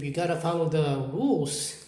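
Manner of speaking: ordinary delivery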